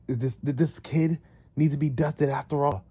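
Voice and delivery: high-pitched, stuttering acted threat; menacing henchman. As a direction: offer eagerly to destroy the kid. Servant eager to kill the kid.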